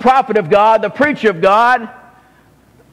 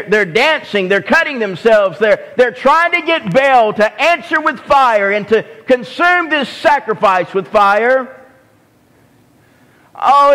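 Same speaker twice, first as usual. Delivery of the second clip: usual speaking voice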